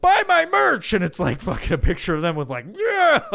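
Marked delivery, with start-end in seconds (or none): 0.0-0.9 high, loud mock pitch; mocking a merch plug; mock huckster. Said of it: "other side of it's like". 2.8-3.2 very high loud laughing yeah; mock cheer.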